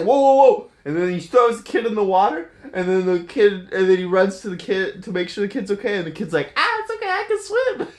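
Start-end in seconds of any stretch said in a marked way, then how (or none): 0.0-0.7 loud, high "whoa whoa whoa", hands-up alarm; alarmed shout. Acted. Steve reacts to the threat. 6.6-7.9 put-on much higher, squeaky little kid reassuring. Rescued kid answers cheerfully.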